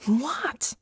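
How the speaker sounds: breathless confused whisper; whispered what. Acted. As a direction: whisper so as not to wake them. Human asleep, keeping voice down.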